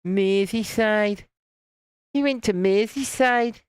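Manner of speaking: stretched-out put-on Scouse; Scouse accent. Shown as a demonstration. Streamer testing a Scouse accent on viewers, exaggerated Liverpudlian. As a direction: have another go at a Liverpool accent. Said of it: "was that a good Scouse accent?".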